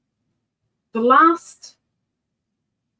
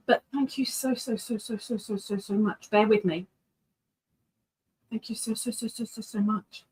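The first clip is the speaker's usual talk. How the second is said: softer, repeating so over and over; soft gushing thanks; 8 dB quieter than usual. Real, not acted. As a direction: breathe out heartfelt thanks.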